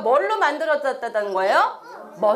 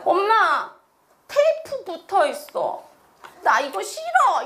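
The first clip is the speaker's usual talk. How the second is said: put-on unhappy young son; 3 semitones higher, slower than usual. Youngest son grumbling to mom about torn money, unhappy. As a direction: complain to mom like an upset kid. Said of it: "이러는 거예요".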